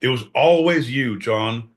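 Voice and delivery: ordinary talk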